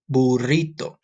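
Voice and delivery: "Burrito" with a rolled r, boo-RREE-toh; Spanish pronunciation. Shown as a demonstration. Teacher repeats the Spanish "Burrito" for students. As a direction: trill the r in "Burrito". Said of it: "Let's say it in Spanish".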